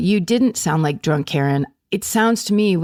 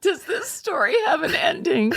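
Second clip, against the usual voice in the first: laughing; 11 semitones higher, 4 dB quieter than usual. Retold or imitated.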